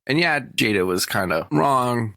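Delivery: normal talking voice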